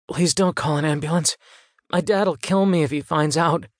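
put-on pleading boy. A narrator's reading. Injured boy begs the rescuer not to call, mildly pleading.

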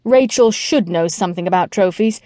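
ordinary delivery, nothing marked

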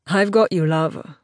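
lightly voiced baritone Englishman; a hint of a baritone male character; deep baritone. A narrator's reading. Man steadying a woman in a stalled elevator, lightly voiced baritone. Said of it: "said in a deep baritone voice".

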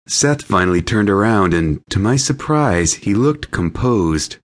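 ordinary delivery, nothing marked

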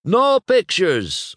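high, loud, slow command; shouted order. Acted. Luigi to photographers, loud order. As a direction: forbid it, stretching both words. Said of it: "ordered Luigi".

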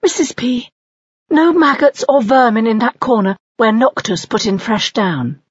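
high, bright-eyed mother owl. Acted. Mother owl to the nest snake, high voiced.

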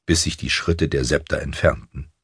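plain reading voice

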